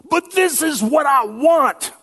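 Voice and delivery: high, loud, whiny mock craving voice; voice of desire. Preacher voicing selfish desire to the congregation, high whiny demand. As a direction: squeal it like a spoiled child wanting something. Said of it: "the voice of my desires says".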